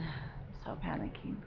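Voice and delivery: breathless frightened whisper; a whispered panic; panicked whisper. Real, not acted. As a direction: whisper it as if too scared to speak aloud. Reliving the sinking in panic.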